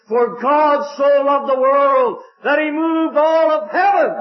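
high, slow, swelling with wonder; soaring preacher's cry. Acted. Preacher to his congregation, soaring high and slow. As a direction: lift the verse up to heaven, drawing it out. Preacher repeats the verse, rising fervently.

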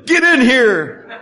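slow, high, laughing call; eager laughing welcome. Retold or imitated. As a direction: beckon him in with delighted relief. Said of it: "they willingly accepted him".

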